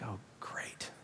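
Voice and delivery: barely voiced whisper to oneself; dreading aside. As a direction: whisper it under your breath, acted dread.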